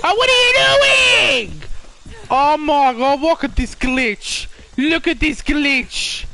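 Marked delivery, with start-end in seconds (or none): 0.0-1.6 loud high wail of dismay; crying shout, much higher; high crying shriek. Real, not acted. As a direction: shriek it like a cry.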